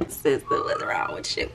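very high put-on voice; mock voice. Scolding the camera in a very high voice. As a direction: squeak it at the camera like a different person.

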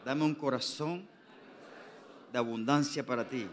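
slow, loud prayer said for all to repeat; congregation prayer lead. Shown as a demonstration. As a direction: lead the church in prayer, word by word. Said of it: "diga conmigo".

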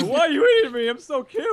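high, cutesy little animal voice; hammed-up high koala plea; pleading koala. Voices the koala being eaten.

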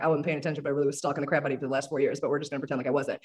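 playful guilty undertone; sheepish confession. Retold or imitated. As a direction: confess the stalking under your breath. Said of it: "my type of people and being like".

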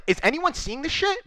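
high-pitched, disbelieving question; incredulous. Retold or imitated. Streamer recounting his disbelief at the team's leaks, incredulous. Retelling own disbelief at team.

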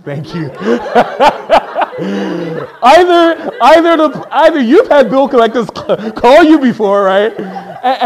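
high, loud laughing through the words; loud laughing reply to the crowd; laughing hard. Real, not acted.

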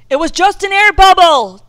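loud, high, far-carrying call; shouted all-clear to people far off. A narrator's reading. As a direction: call reassurance across a frozen lake. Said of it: "Dad called to us".

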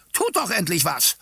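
high, impatient woman's cry; piercing, fed-up demand; shrill shout. A narrator's reading. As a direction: shrilly urge them to act. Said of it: "rief sie mit schriller Stimme".

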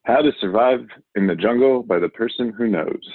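ordinary delivery

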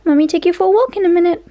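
high, quick, doting promise; pet talk. Real, not acted. Promising the dogs a walk.

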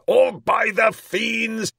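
slow, high, loud menace; shouted threat. A narrator's reading. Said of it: "shouted the monster".

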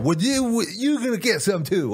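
high, pushy imitation of a yelling woman; mock threatening street lady. Imitating the homeless lady who turned on him, pitched high and pushy.

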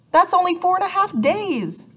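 high-pitched, alarmed exclamation; exclaiming. A narrator's reading. Jessie realizing how little time is left, exclaiming. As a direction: cry out in dismay, pitch leaping up. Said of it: "Jessie exclaimed".

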